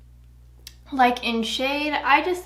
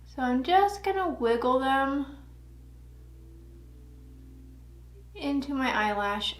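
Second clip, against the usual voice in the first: talking while applying mascara; distracted, mid-mascara application; 2 semitones higher, 5 dB quieter than usual. Real, not acted. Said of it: "it's hard to talk and do mascara".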